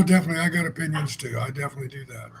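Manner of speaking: ordinary talk, nothing marked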